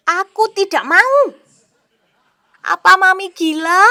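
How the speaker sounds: put-on shouting child refusing, high and loud; loud refusal. Son yelling back at his mother, loud and high. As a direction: yell it like a defiant kid.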